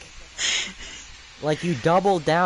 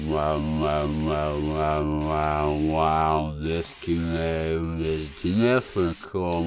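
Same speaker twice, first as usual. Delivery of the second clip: deep voice; 13 semitones lower, 4 dB quieter, much slower than usual. Pretend. Deep slowed voice.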